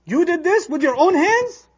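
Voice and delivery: furious shout. Shown as a demonstration. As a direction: hurl the accusation, slow and loud. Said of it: "how you speak when you're extremely angry".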